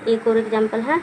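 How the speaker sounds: usual speaking voice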